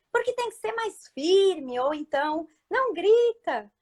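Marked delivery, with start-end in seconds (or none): 0.1-1.8 relative's advice. Retold or imitated. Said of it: "todo mundo dá palpite". 2.7-3.7 relative's advice. Retold or imitated. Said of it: "todo mundo dá palpite".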